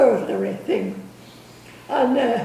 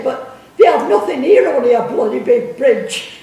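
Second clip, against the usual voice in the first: louder, indignant comeback; mad retort; 8 dB louder than usual. Retold or imitated. Geoffrey finally blowing his stack at the officer, loud indignant retort. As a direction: fire back at the rude officer. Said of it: "he was that mad at him".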